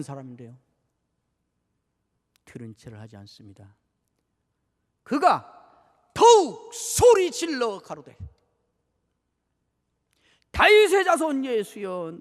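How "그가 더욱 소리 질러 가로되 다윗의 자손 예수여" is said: loud, slow, drawn-out cry; proclaiming cry. Acted. Preacher voices the blind man crying to Jesus. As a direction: cry out the verse for mercy.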